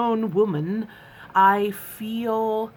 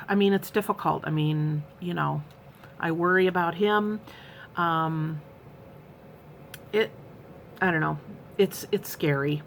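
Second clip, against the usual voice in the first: ordinary talk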